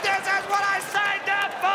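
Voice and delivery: high, loud delighted yell; gleeful shout. Retold or imitated. Soldier about to toss the comic, gleeful shout. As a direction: whoop like it's the best day ever. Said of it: "the smile he got on his face".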